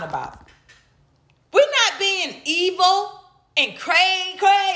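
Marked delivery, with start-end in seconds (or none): 1.5-4.7 high, slow hammed-up "evil and cray"; mock crazy voice. Mocking evil crazy stereotype.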